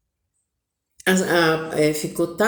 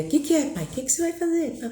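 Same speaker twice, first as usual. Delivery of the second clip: put-on higher, insistent child's nagging; pestering kid; 7 semitones higher, 3 dB quieter than usual. Children pester their father for the news, childlike. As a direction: nag like an impatient kid.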